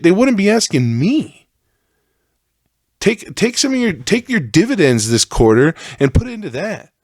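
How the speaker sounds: heated high-pitched tirade at Safeway; worked-up rant. Real, not acted. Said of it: "now I'm mad. How did venting piss me off?".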